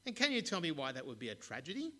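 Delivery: loud, fast eager question. Acted. The mayor eagerly quizzing the boy, loud and fast.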